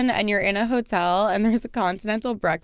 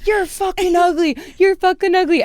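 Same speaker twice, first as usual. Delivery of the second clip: put-on much higher, whiny drunk jeer; mock drunk insult; 7 semitones higher than usual. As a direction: ham up the sore loser. Drunk guy insults her.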